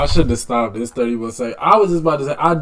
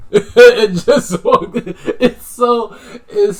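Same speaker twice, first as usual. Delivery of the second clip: laughing; 8 semitones higher, 5 dB louder, slower than usual. Real, not acted. Laughing about the scene.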